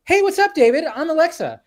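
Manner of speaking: very high, loud, perky greeting; over-chummy voice assistant. Retold or imitated. Upgraded Alexa greeting its owner, high chummy imitation. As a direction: imitate an AI suddenly acting like your pal. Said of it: "all of a sudden it went from".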